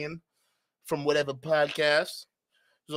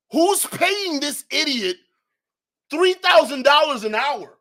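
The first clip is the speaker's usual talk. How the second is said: outraged; 8 semitones higher, 5 dB louder than usual. Real, not acted. Outraged at the price.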